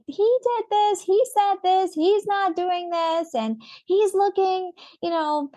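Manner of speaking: put-on high-pitched tattletale kid; tattling child. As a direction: imitate a child telling on a sibling. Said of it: "they come and tattletale".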